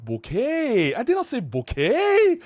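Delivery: "bokeh" drawn out slowly with a stretched final syllable; mock-protest, dragging the end of "bokeh"; exaggerated wrong bokeh. Shown as a demonstration. Mocking the mispronunciation he is accused of.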